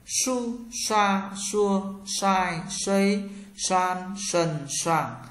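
"Su, xoa, xua, xoai, xuây, xoan, sần, xoàng" with tongue curled back; retroflex sh. Shown as a demonstration. Teacher drills sh for learners, "Su, xoa, xua, xoai, xuây, xoan, sần, xoàng" with curled tongue. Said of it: "S H các bạn cong lưỡi".

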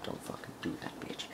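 unvoiced, hushed cursing; hushed curse. Real, not acted. YouTuber scolding spammers under his breath.

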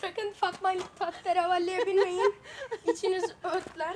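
talking through laughter; laughing while warning viewers. Real, not acted. Laughing after rough seesaw.

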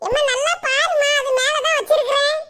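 put-on high, young girlish voice; high daughter voice calling her mother; daughter Poomari voice. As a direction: play the whiny young daughter. Daughter voice in skit.

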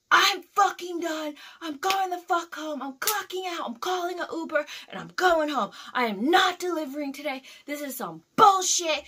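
high, loud ranting, flipping out; pissed off. Retold or imitated. Driver rants at coworker after the crash, furious shouting. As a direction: re-enact a furious outburst at full volume. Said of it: "And I was pissed".

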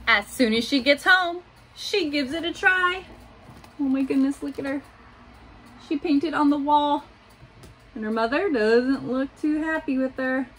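put-on gentle picture-book reading for little kids; reading to children. A narrator's reading. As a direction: read it like story time in class. Teacher reads picture book aloud.

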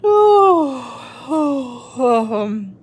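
sleepy yawn. A narrator's reading. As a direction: yawn the words out. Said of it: "yawned Mister Tortoise".

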